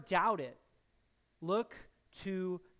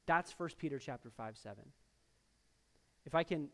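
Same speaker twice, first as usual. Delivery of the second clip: ordinary talk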